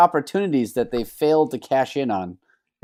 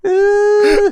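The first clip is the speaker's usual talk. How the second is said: loud, high sustained "ooh"; high held "ooh"; 7 dB louder than usual. Retold or imitated. Joking with friends about the Soul Glow scene, high mock wail. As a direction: hold a long falsetto-high note.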